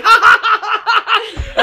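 high, loud, bright cackle of laughter; girl laughing. Retold or imitated. Girl amused by his shaking knees, laughing high and loud. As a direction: laugh like she's enjoying his bit.

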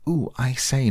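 high little cry of agitation; flustered exclamation. A narrator's reading. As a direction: squeal it in mild panic.

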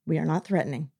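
low, soft, even reply; calm general. Retold or imitated. General calmly answering the yelling prince. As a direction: imitate an unruffled general, quietly. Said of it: "really calmly just kind of".

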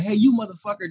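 a big angry man shouting a threat; threatening relative. Retold or imitated. Relative yelling at the witness outside court, mock angry shout. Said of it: "He was like".

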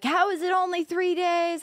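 high, outraged disbelief, imitated; freaking out. Streamer imitating the internet's reaction to the ban, freaking out. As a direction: mimic an internet crowd losing it. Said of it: "the internet's freaking out, losing it".

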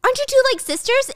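put-on high, teasing young woman voice; Stacy voiced. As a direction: lift the pitch high and tease. Stacy's voice, mocking suggestion.

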